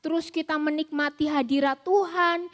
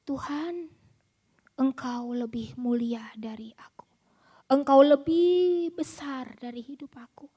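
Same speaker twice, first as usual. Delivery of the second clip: whispered prayer; 4 semitones lower than usual. Pretend. Worshipper bowing and praying to God in a whisper.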